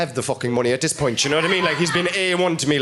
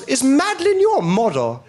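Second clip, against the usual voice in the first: high, slow, loud robber; 8 semitones higher, 3 dB louder, slower than usual. Retold or imitated. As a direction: call it out high and slow with a laugh. Robber voiced in story.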